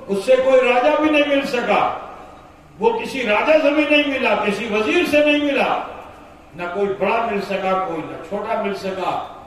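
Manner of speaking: high, loud proclaiming; preaching loud. Acted. Servant tells Umar his fortune, loud and high. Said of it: "کہا".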